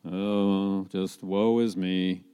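low, slow, gloomy moan; Eeyore. Acted. Walking around with the rain cloud over him, gloomy. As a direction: sigh it out, sunk in self-pity. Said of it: "Remember Eeyore from Winnie the Pooh?".

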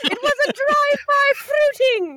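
high, hammed-up British nanny voice; Mrs. Doubtfire. Quoting the film to co-hosts, high mock British nanny voice. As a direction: cry out in a badly faked British falsetto. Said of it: "This is Doubtfire".

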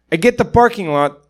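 imitating a Nepali comic, laugh creeping in; thick Nepali accent. Nepali comic tells of parking lot threat, thick accent. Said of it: "this really thick accent".

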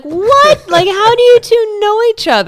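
seeing her date greet her boss, shocked shout; shocked squeal. Retold or imitated. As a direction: cry out in shock. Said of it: "And I'm like".

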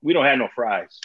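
a lightly imitated bored reply; deadpan. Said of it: "deadpanned and said".

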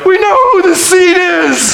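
high, very loud preaching; triumphant shout. Real, not acted. Preacher proclaiming the seed to the congregation, triumphant shout. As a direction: proclaim it slowly at full voice.

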